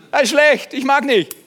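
high, loud whining protest; whiny complaint. Retold or imitated. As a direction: whine like a reluctant believer. Voices complaining believer. (hohes, lautes Jammern)

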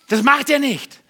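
high, loud, impassioned preaching; fiery preaching. Real, not acted. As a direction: preach it with full force. Preaching at full force. (leidenschaftlich, laut gepredigt)

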